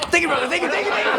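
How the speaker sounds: very high, gushing thanks; mock gushing thanks. Comedian voicing a freed refugee thanking the customer, gushing. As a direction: gush with overdone gratitude.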